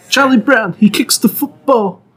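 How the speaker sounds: put-on Italian American mobster accent; laughing tough-guy mobster. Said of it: "bust out your mobster impression".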